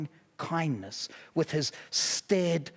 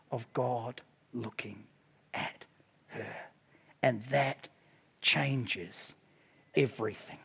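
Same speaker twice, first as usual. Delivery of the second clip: hushed emphasis; 8 semitones lower, 7 dB quieter than usual. Acted. Preacher to congregation, hushed and slow. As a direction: drop much softer and lower, draw it out. Preacher slows for revelation moment.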